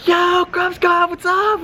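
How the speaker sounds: high-pitched, drawn-out excited holler; hyped call. Retold or imitated. Hailing a pack of Groms, hyped high-pitched call. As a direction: imitate an excited shout to a passing group. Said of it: "Like, I'm hyped as shit".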